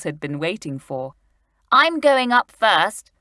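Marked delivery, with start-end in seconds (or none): 1.7-3.1 louder, slow eager call. A narrator's reading. Julian claiming the first climb, eager. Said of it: "cried out Julian eagerly".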